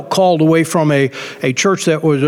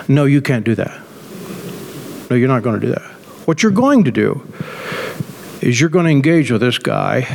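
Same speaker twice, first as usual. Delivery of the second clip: firm gentle voice of God; 5 semitones lower than usual. Retold or imitated. God's voice in his head.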